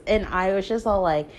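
ordinary talk, nothing marked

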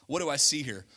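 normal talking voice

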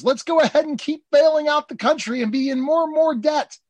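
high, mocking Republican; lampooning Republicans, pitched high; a sneering Republican, voiced up high. Voicing Republicans.

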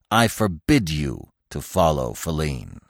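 low, slow forbidding; angry command. A narrator's reading. Carus forbidding Bambi to follow Feline, sternly angry. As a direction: lay down the order slowly and low. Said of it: "Carus commanded angrily".

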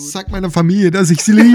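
loud, high dying last words; overacted death scene. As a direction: ham up a dying man's farewell. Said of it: "Komplett übertrieben".

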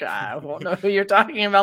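lightly voiced puzzled man; a hint of a wary stranger; confused stranger. Man denying he is the teacher, lightly imitated. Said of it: "looked at me all weird".

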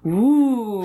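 high loud "ooh". Real, not acted. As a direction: react with a scandalised ooh. Reaction to shady trick.

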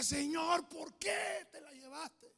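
high, loud, slow cry to heaven; anguished shout. Retold or imitated. Grieving boy crying out to the Lord over his grandmother, very high and loud. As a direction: shout the grief at God. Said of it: "Y le decía".

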